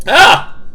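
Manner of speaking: short, high, loud "ah"; sudden shriek of surprise; startled scream. Real, not acted. Startled as character appears.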